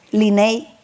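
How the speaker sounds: low Linney with the S left out; dropped S. Retold or imitated. Imitating husband's hurried call, dropped S. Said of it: "le quita la S a mi nombre".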